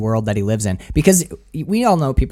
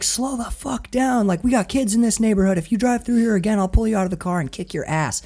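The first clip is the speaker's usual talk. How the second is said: angry neighbor; 7 semitones higher than usual. Retold or imitated. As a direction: lay down the law to the speeding kids. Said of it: "and he's like".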